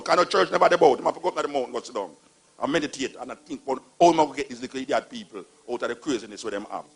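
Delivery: usual speaking voice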